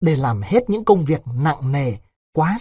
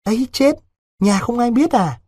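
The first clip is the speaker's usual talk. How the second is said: shocked gasp; 8 semitones higher than usual. A narrator's reading. Vịnh shocked at stolen money.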